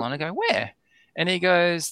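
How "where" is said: mock surprised where. Retold eager question.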